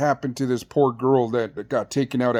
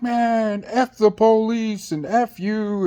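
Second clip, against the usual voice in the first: much higher, slow mock yell; mock screaming inmate; 8 semitones higher, slower than usual. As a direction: imitate him yelling at the guards, pitched up and drawn out. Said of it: "he's over there screaming in the cell".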